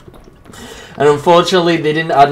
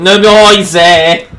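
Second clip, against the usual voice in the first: higher, loud burst of alarm; alarmed cry; 5 semitones higher, 11 dB louder, slower than usual. Real, not acted. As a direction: call out in sudden alarm. Alarmed on spotting the killer.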